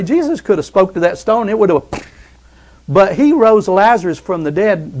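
loud, high, driving sermon delivery; full-force preaching. Acted. Pastor preaching miracles to church, at full force. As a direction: preach it out to the congregation.

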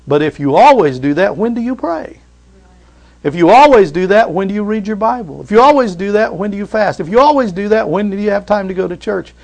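repeated preaching build; preaching refrain. Acted. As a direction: drive home each question.

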